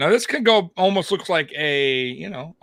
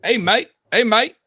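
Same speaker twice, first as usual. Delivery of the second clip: a playful stab at an Aussie, higher and louder; loud put-on Aussie "Hey, mate"; mock Aussie accent; 10 semitones higher, 7 dB louder than usual. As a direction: greet like a cheery Australian bloke, a bit hammed-up. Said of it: "I would love to have an Aussie accent".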